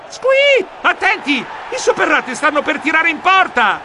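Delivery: high, frantic warning cry; alarmed squeak. Acted. Geronimo warning the team of the shot, high panicked squeal.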